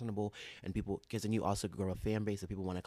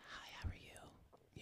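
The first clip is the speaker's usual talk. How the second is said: sultry voice; 17 dB quieter than usual. Acted. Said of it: "let me stop talking that voice".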